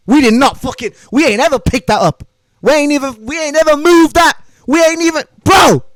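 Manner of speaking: rising to a high, loud shout; escalating gym boast; building emphatic shout. Real, not acted. Building boast about weight.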